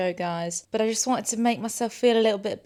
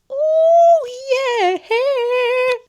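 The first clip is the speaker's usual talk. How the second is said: very high, loud sung-out yeah; gleeful squeal; delighted squeal; 12 dB louder than usual. Real, not acted. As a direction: show off the lunch. Proud of lunch.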